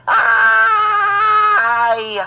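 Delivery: a sharp, high, loud yelp of pain. Acted. Boy getting the anesthesia shot yelps at the doctor. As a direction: shriek as the needle goes in. Kid hit with the anesthesia needle.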